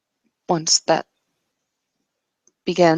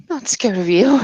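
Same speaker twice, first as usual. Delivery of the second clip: higher and louder, pushing back; defiant denial, voice raised; defiant insistence; 5 semitones higher, 4 dB louder than usual. Acted. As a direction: insist she is not afraid. Character defies his claim.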